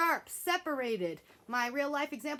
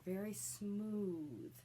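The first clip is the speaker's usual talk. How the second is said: softer, slow very smooth; 2 semitones lower, 7 dB quieter, much slower than usual. Shown as a demonstration. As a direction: glide through it. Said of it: "all the notes are connected".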